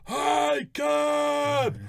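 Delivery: high, drawn-out shout; imitated long-distance holler. Fan spotting him two lanes away, hollered. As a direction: holler it across a shopping centre. Fan calls across shopping centre.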